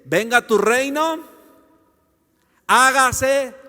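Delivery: emphatic prayer prompt; reciting a prayer. Shown as a demonstration. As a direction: proclaim the prayer line, leaving it open. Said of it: "la oración dice".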